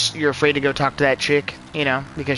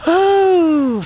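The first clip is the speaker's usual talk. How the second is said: loud, high "ooh"; long high release after laughter; high whoop after laughing; 14 semitones higher, 7 dB louder than usual. Real, not acted. Streamer amused by own joke, high loud whoop.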